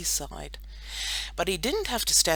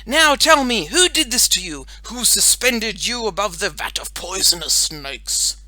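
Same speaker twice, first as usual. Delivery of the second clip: dramatic superhero questioning; 5 semitones higher, 9 dB louder than usual. A narrator's reading. Boy superhero questions dangling girl, dramatically. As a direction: interrogate like a melodramatic hero.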